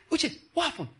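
hammed-up God pestering for details; mock fussy God. Preacher mocks the idea of a nosy God: high, fussing. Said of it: "He said".

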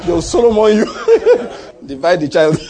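high-pitched tease broken by laughter; laughing joke. Real, not acted. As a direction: joke through real laughter. Joking about Solomon dividing people.

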